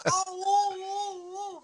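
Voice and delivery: repeated high wails of pain; hammed-up, very high agonized wailing; loud howl. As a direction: re-enact howling in someone's face. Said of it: "as loud as I could".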